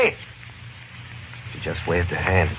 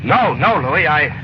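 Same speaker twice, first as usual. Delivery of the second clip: nervous, higher, tumbling denial; flustered denial; 6 semitones higher than usual. Acted. Cabbie lies to dispatcher Louis, gun at his head, frightened. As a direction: talk fast to cover up a lie with a gun on you. Panicked lie at gunpoint.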